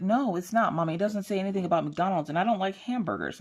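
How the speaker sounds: put-on high, complaining kid voice; protesting child. Daughter disputing mom's McDonald's claim, high protest. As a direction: imitate a child objecting to mom.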